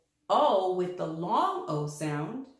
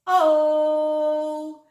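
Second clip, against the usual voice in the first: loud, high shout of O; 6 semitones higher, 9 dB louder than usual. Shown as a demonstration. Teacher shouting the vowel to the class. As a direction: call O out loud like at a party. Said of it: "we're going to shout out O".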